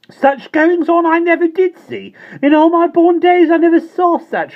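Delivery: very high, loud prim scolding; indignant landlady. A narrator's reading. Landlady scolding her tenant, high and indignant. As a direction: scold him like a scandalised housekeeper.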